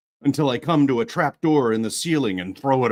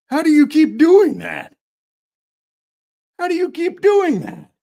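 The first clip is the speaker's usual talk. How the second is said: very high, loud chirpy voice; excited chirrupy sing-song; chirrupy Pepper; 8 dB louder than usual. Acted. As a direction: bubble over with wonder, squeaky high. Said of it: "chirrupy sing-song voice".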